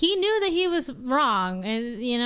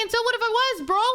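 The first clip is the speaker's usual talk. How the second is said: high, incredulous retort without the laughing; challenging comeback; 6 semitones higher, faster than usual. Retold or imitated. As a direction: throw it back at him, composed. Repeats the comeback after the laugh.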